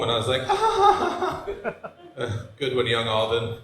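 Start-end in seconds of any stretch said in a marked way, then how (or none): 0.5-1.9 high fake ha-ha laugh, pitched way up; mocking laugh. Comic mocking his old bucket list, high fake laughter. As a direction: scoff at his younger self's dream with a high fake laugh.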